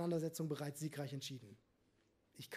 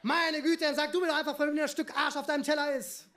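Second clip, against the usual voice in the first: much higher and louder, shouting across the restaurant; furious shout; 8 semitones higher, 8 dB louder than usual. A narrator's reading. Steffi losing patience with Marco, a furious shout. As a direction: blow up at him so the whole room hears. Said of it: "An den umliegenden Tischen verstummten die Gespräche".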